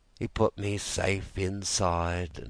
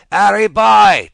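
loud, much higher yell across the siding; shouted call; 18 dB louder than usual. Acted. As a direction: call him from far off, throats dry. Troops shouting for water.

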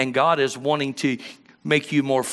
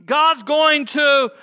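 slow high shouted preaching; preacher shouting; 13 semitones higher, 6 dB louder, much slower than usual. Retold or imitated. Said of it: "So they'll say".

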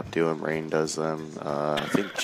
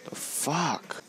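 very high disbelieving yelp; high, stunned "the fuck"; shocked squeak; 10 semitones higher, 4 dB quieter than usual. Real, not acted. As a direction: jump way up in pitch, caught off guard. Gamer shocked by a kill.

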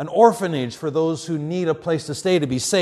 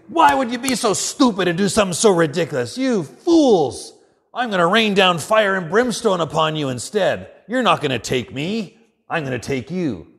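angry reaction; 4 semitones higher than usual. Acted. Imagined Jesus rebuking his tormentors, acted anger. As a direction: rail at them in mock divine anger. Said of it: "react in anger".

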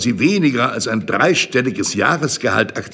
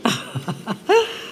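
pleased laugh; 10 semitones higher, 5 dB quieter than usual. Acted. Man laughs, pleased with her.

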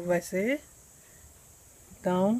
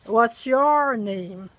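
slow, deliberate "What's your name?"; each word laid out slowly; slow model question; much slower than usual. Shown as a demonstration. Teacher modelling the question slowly for the students.

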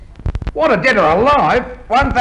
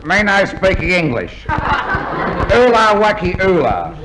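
put-on Chinese pidgin English; mock Chinese accent. Wanted man posing as a mandarin to an inspector, mock Chinese accent. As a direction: fake a Chinese accent, spouting nonsense. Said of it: "an old Chinese mandarin".

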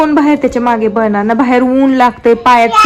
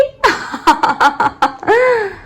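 laughter; 10 semitones higher than usual. Real, not acted. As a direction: burst into a long laugh. Laughing with her child.

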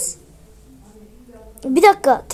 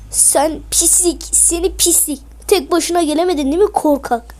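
usual speaking voice

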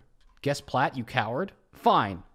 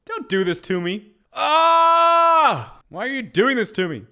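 mock anguished plea; 10 semitones higher, 8 dB louder, slower than usual. Real, not acted. Streamer pleading at guess.

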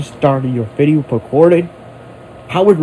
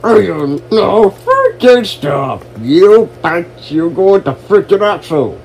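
angry rant; 5 semitones higher, 5 dB louder than usual. Acted. Ranting at whoever wrecked his stuff, furious. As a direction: yell it at them in full rage.

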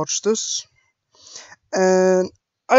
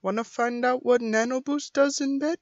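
lightly voiced character line, pitched higher; higher, drawn-up questioning character voice; Ana; 6 semitones higher than usual. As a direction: raise the pitch into a teasing question. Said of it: "For Anna".

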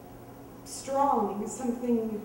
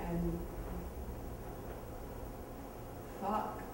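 hushed calm narration; 2 semitones lower, 11 dB quieter than usual. Acted. Quiet calm telling of the drowning.

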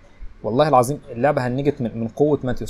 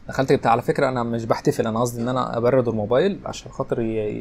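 ordinary delivery

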